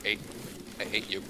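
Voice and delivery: normal talking voice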